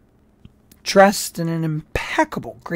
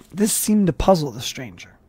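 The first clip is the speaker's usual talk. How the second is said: ordinary delivery, nothing marked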